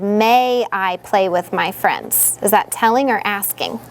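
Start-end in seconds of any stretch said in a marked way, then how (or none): 0.0-2.4 "May I play with my friends?" read back very slowly, word by word. Retold or imitated. Teacher reads the child's sentence back to the class, slowly. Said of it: "You said".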